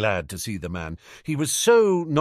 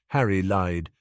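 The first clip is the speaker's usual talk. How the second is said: plain reading voice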